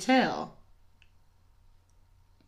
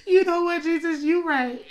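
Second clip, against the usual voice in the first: mock crying; 8 semitones higher than usual. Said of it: "start crying".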